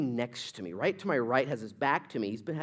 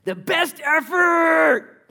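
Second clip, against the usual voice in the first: slow, loud, higher preaching shout; 13 dB louder, much slower than usual. Real, not acted. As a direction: hammer it out slowly at full voice. Preacher driving home his point.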